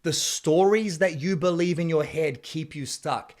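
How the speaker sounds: usual speaking voice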